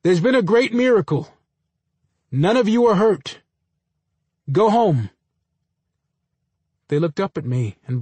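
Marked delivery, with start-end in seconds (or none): 0.0-3.4 high, raised voice to the masses; big public proclamation, pitched up; big announcing voice. A narrator's reading. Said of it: "I made my voice big and announced to the masses". 4.5-5.1 loud, high command to the masses; big, raised public order; big announcing voice. A narrator's reading. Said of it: "I made my voice big and announced to the masses".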